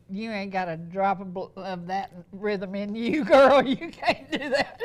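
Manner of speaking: retold teasing that breaks into loud laughter; mock scolding dissolving into a laugh. Teasing daughter in retold quote, laughing.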